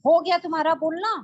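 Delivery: put-on high, loud, firm parent cutting a child off; stern parent. Parent to a child who has finished shouting, high and loud. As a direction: done now, enough shouting.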